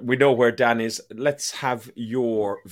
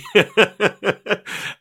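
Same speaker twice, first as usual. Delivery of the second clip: laughing; 9 semitones higher, 7 dB louder than usual. Real, not acted. Laughs at own bit.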